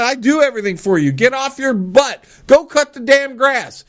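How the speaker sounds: put-on a dad telling the kid to get off his butt; fed-up parent rant. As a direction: nag the lazy kid hard. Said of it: "I mean, this is me".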